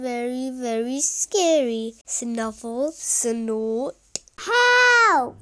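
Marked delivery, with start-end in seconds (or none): 4.4-5.4 high, loud cry for help; frightened yell. Acted. Boy hearing a real monster, yelling for help. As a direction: scream for help from under the covers. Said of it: "yelled Kipper".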